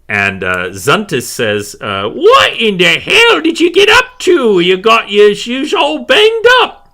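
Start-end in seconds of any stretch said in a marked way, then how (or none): 2.1-6.9 high, loud scolding crone; shouted high-pitched old-woman scolding; grumpy old woman. Acted. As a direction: screech it like a cross old healer. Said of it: "by a very grumpy old woman".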